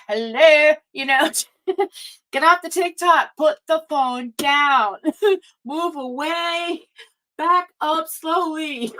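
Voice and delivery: a mock-stern angel scolding with laughs, slowing right down on the last orders; lightly voiced archangel telling you off, half-laughing; a light scolding archangel voice, not far from her own, that breaks into laughs; Archangel Michael, playful. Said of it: "the Archangel Michael steps in and says".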